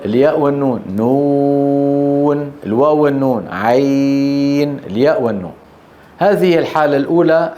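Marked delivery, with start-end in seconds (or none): 1.0-2.5 very slow, held long vowel; stretched recitation. Shown as a demonstration. Teacher stretches letter name. 3.5-4.8 very slow, held long vowel; stretched recitation. Shown as a demonstration. Teacher stretches letter name.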